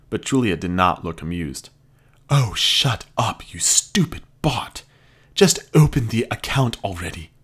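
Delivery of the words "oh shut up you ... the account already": fed up, spit it out; irritated snap. Acted. Julia snaps at robot.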